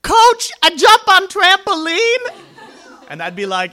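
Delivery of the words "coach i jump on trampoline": put-on high, loud little-kid shout; drawn-out, piping toddler announcing it proudly. Two-year-old calling to the gymnastics coach, high excited toddler voice. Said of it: "two-year-olds would call me coach".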